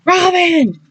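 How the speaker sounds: high, loud shriek of a name; alarmed shriek. Acted. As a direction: shriek her name in fear. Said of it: "Willow shrieked".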